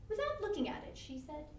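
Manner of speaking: ordinary delivery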